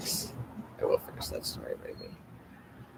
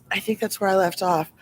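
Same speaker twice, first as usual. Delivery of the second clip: ordinary delivery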